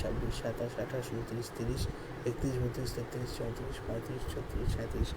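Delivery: very soft, low undertone count; counting to oneself. Real, not acted. Teacher tallying apostrophes on the page, counting quietly to oneself. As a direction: count along quietly under your breath.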